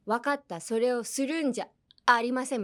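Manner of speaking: stern それをするんじゃありません, broken by a beat; scolding with pauses. Acted. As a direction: scold firmly, leave a gap before ありません. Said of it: "間入れるじゃないですか".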